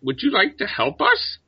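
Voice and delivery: high, rising creature voice; bird creature voice. Acted. Game master's bird creature asks adventurers for help, high creature voice. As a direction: pitch it high like a talking bird.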